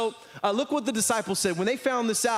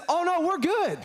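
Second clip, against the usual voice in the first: breezy overconfidence; 10 semitones higher than usual. Acted. Disciples breezily overconfident in reply.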